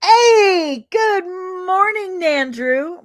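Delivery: high, loud, bright hello to a friend; excited greeting. Real, not acted. Greeting a viewer by name, excited and loud. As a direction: burst out with a delighted hello.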